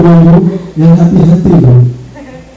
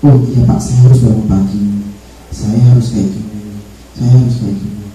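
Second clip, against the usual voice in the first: soft, lower student reply; a resolved pupil answering; lightly voiced student; 6 semitones lower, 4 dB quieter than usual. Student finds own solution. (murid menjawab pelan dan sadar diri)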